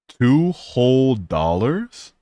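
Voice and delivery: slow, drawn-out sarcastic whine; slow sarcastic drawl; mock outrage. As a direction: stretch it out in mock disbelief. Sarcastic about tiny earnings.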